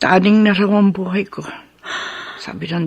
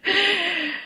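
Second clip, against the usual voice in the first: fond laugh; 12 semitones higher, 8 dB quieter than usual. Acted. Mother laughing at a fond memory.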